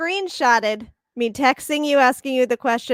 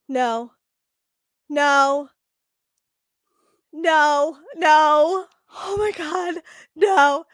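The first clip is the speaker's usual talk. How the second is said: horrified denial; 3 semitones higher, much slower than usual. Retold or imitated. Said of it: "where I went".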